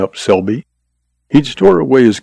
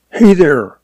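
higher-pitched hail; lightly raised calling voice; 7 semitones higher than usual. A narrator's reading. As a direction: call out sharply to stop someone. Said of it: "Frayne called".

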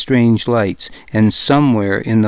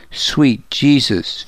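slow, understated grief; a touch of heartbreak, drawn out; heartbroken; 3 semitones higher, slower than usual. A narrator's reading. Finan grieving over his wounded friend, mildly heartbroken. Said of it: "He sounded heartbroken".

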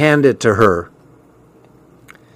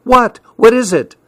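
high, alarmed questions; startled and alarmed, pitched high; 10 semitones higher than usual. Acted. Friend startled by scream.

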